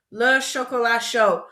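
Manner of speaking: normal talking voice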